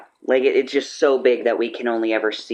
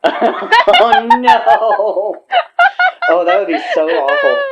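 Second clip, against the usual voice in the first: mock horror; 9 semitones higher, 7 dB louder, much slower than usual. Real, not acted. Horrified at the fire going out.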